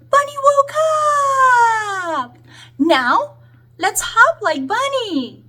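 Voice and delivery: high, loud, delighted; excited. Acted. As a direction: cheer the news to the kids. Bunny wakes, excited.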